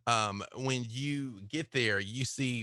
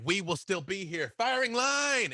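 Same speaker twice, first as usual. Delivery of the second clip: Jake calling out; 9 semitones higher than usual. Acted. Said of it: "He's like".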